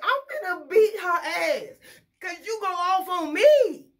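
loud, high-pitched outrage; fired-up threat over the headset; angry threat. Retold or imitated. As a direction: yell it, worked up and indignant.

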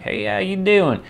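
high, drawn-out Southern greeting; Southern accent. Retold or imitated. Someone from the South saying hello, hammed-up Southern accent. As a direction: imitate a slow Southern US hello. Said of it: "someone from the South like".

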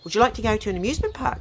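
high, quick mock wife voice; wife's voice. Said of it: "eventually she says".

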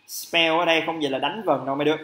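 ordinary delivery

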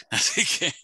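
chuckling at his own age joke; a light laugh breaking into the line. Real, not acted. Panelist laughing at his own joke, light laugh. Jokes about being oldest.

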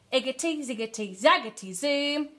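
lightly voiced witch's incantation; casting a spell. A narrator's reading. Witch stirs her cauldron, chanting a spell. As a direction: chant the magic words with a lift at the end. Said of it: "she muttered a spell".

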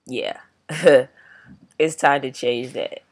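normal talking voice

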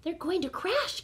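high, gasped alarm. A narrator's reading. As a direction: gasp it out in worry. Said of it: "gasped Stella Luna".

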